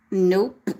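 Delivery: ordinary delivery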